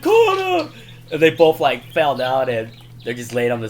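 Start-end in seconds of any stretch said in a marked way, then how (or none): 0.0-0.7 loud, very high yell; mock panicked shout. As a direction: shout it as an imitated urgent warning. Acted panicked call in truck stunt.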